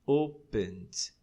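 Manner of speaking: opened said slowly in two syllables, "open-d"; opened with no extra syllable; ED as only D. Shown as a demonstration. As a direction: add just a D to open in opened. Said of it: "só pronuncia o D".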